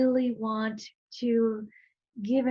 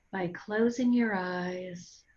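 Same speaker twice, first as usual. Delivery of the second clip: guided meditation. Real, not acted. Guide leading listeners to breathe and centre, calm guided meditation. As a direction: guide them gently inward, unhurried, phrase by phrase.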